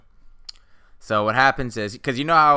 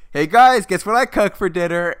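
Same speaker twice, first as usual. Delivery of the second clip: cheery dad; 7 semitones higher, 5 dB louder than usual. Retold or imitated.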